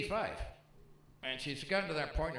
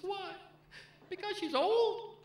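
put-on much higher, offended old-lady voice; mock old mother; 14 semitones higher, 4 dB louder than usual. Son voicing his elderly mother's accusation, high indignant mock voice. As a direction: squeak it out like a wounded old mom.

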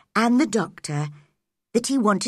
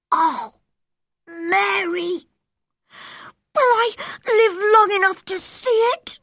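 put-on very high, overwhelmed boy; pleading child, pitched right up; Colin, thrilled and anxious; 12 semitones higher, 4 dB louder than usual. A narrator's reading. Sick boy learns of the garden, high and excited.

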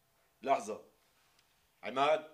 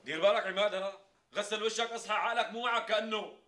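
loud; 12 semitones higher, 10 dB louder than usual. Acted. Scolds sleepy friend.

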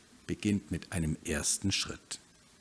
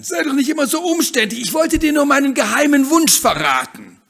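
high, loud, bubbly hen voice; lively bossy hen; 15 dB louder than usual. Acted. Hen eager to leave the backyard, loud and high. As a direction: push ahead full of cheer.

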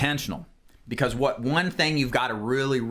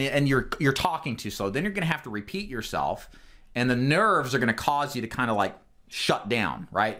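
ordinary talk, nothing marked

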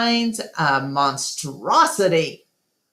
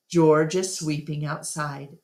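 ordinary delivery, nothing marked

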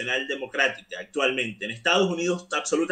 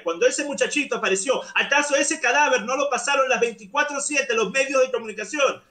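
heated, higher-pitched rant; worked up; 4 semitones higher than usual. Real, not acted. Debater indignant at media double standards, worked up. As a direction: argue insistently, pitch raised throughout. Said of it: "No sé por qué te pones así".

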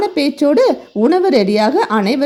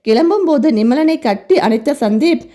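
usual speaking voice

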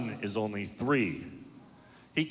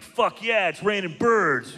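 higher, gleeful metalhead cheer; 8 semitones higher, 3 dB louder than usual. Retold or imitated. A metalhead reacting to birds falling from the sky, gleeful.

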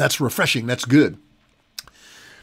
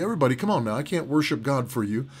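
normal talking voice

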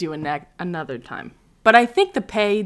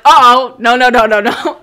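loud, higher-pitched mock panic; retold alarm; 4 semitones higher, 14 dB louder than usual. Retelling her reaction to friends, loud mock alarm. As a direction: blurt it out loud in comic alarm.

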